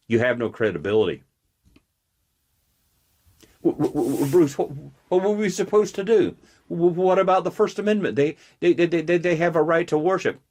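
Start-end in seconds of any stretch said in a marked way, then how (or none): 3.6-10.4 mocking stammer. Mocking the assembly's stammering excuse.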